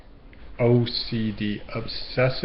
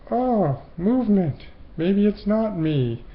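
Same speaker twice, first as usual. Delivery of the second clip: excited discovery; 7 semitones higher than usual. Retold or imitated. Imagined relief, noticing space.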